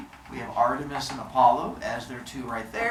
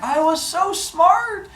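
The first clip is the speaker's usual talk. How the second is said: high, slow, sing-out brag; hammed-up Leto voice; mock boasting girl; slower than usual. Leto brags, mocked by teller.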